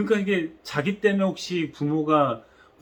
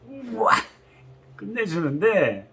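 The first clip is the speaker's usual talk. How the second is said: gleeful wow; 5 semitones higher, slower than usual. Pretend. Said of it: "아주 재밌어 했을 것 같아요".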